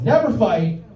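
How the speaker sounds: NEVER FIGHT pitched high; emphatic insistence. Acted. Comedian insisting he and his girlfriend get along, high emphatic repeat.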